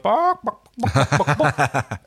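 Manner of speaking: taunting chicken squawk breaking into laughter; mock chicken clucks. Host imitating him taunting her as a chicken, clucking. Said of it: "he called her a chicken".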